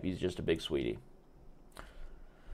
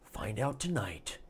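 softer, falling repeat of the tagline; hushed echo; 7 dB quieter than usual. Pretend. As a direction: Find out tonight said quietly like a teaser echo.

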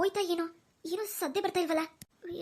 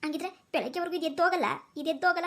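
usual speaking voice

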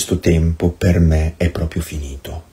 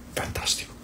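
breathless whisper of delight; a soft whispered fantastico; whispered aside; 17 dB quieter than usual. Real, not acted. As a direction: murmur it to yourself in awe. Ironic aside after the gospel.

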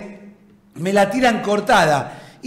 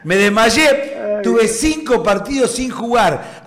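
normal talking voice

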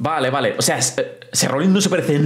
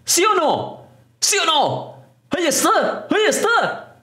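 thrilled shouting; 12 semitones higher, slower than usual. Real, not acted. Cody Rhodes appears.

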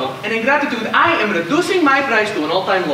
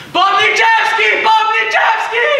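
drawn-out shout, much higher and louder; yelled name; 12 semitones higher, 4 dB louder, much slower than usual. Shown as a demonstration. Drowner showing the gentleman how to yell the name, drawn-out shout. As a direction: bellow the name across the water. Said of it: "yell at the top of your lungs".

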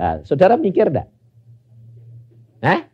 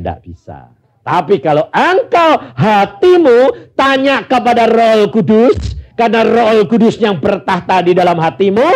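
raised, slowed preacher's exhortation; full-force preaching; 7 semitones higher than usual. Acted. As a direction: drive it home from the pulpit.

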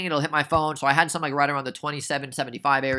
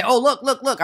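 excited pointing out; 10 semitones higher, 5 dB louder than usual. Acted. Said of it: "I might say".

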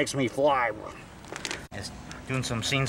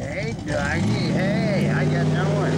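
high, cooing voice for the dog; 5 semitones higher, 3 dB louder than usual. Real, not acted. Man greeting his buddy the dog, high cooing baby talk.

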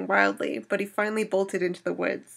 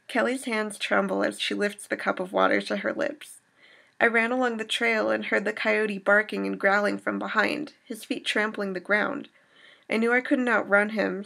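ordinary delivery

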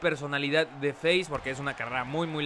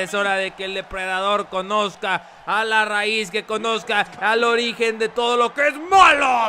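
louder, building villain intro; dramatic announcer; 4 semitones higher, 6 dB louder than usual. Acted. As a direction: welcome them to the hall of evil.

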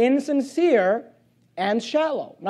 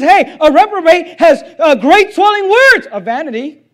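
voicing God; 6 semitones higher, 8 dB louder than usual. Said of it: "God says".

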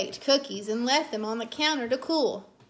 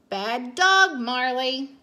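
scolding; 5 dB louder, slower than usual. A narrator's reading. Mommy scolds the dog again.

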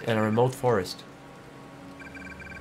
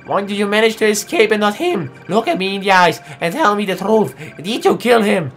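yelling demand, higher and louder; furious shout; 6 semitones higher, 6 dB louder than usual. Acted. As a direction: shout at them to tell the truth. Demanding the truth.

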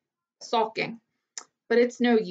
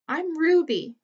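small clear voice; 7 semitones higher than usual. A narrator's reading. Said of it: "small, clear voice".

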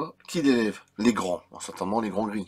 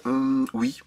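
ordinary delivery

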